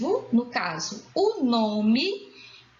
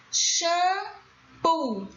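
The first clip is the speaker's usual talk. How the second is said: "Shampoo" in two long, separated syllables, pitched up; split syllables; 5 semitones higher than usual. Shown as a demonstration. Teacher showing the tonic syllable, "Shampoo" split up. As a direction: break "Shampoo" into slow syllables. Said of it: "A sílaba tônica".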